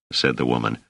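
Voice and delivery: plain reading voice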